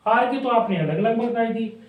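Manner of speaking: normal talking voice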